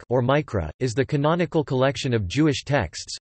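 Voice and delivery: ordinary talk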